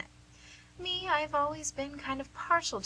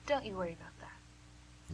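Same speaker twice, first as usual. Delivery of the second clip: whisper; 4 semitones lower, 8 dB quieter than usual. Acted. Nurse soothing wary patient.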